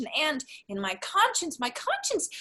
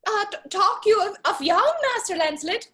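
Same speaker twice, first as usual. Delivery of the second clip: high-pitched disguised voice; comic high voice to fool someone; teasing put-on voice; 8 semitones higher, 3 dB louder than usual. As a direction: give confusing directions in a high mock-helpful voice. Lancelot questions father in disguise.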